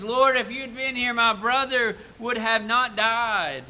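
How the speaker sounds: high, loud anguished words; grieving plea. Acted. Mary crying out to Jesus in grief.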